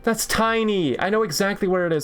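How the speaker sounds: fast, high-pitched indignant rant; indignant protest. Real, not acted. Gamer arguing with a map quiz, indignant protest. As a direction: rattle off your complaint, exasperated. Protests the state is too small.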